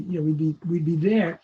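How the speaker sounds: normal talking voice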